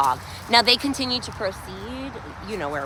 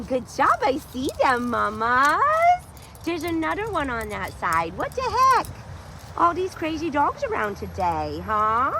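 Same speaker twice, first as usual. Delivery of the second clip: dog-talk voice; 4 semitones higher than usual. Real, not acted. Talking to dog mid-walk.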